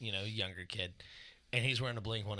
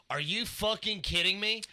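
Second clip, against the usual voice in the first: mock outrage; 9 semitones higher, 5 dB louder, slower than usual. Retelling quarrel with kid.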